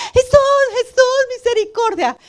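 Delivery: high imploring call; desperate plea. Retold or imitated. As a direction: cry out like someone in need. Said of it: "a veces que nos hallamos". (clamor agudo de quien está en necesidad)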